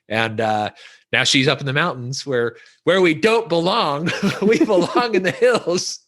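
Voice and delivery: ordinary delivery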